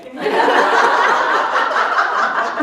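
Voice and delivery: long breathy laugh; unvoiced laughter; laughing at the memory. Real, not acted. Laughing at the vampire story.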